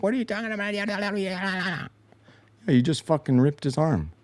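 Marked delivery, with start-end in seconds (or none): 0.0-1.9 higher, whiny mock protest; mock indignant player. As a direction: play the big man as an offended whiner. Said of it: "He's like".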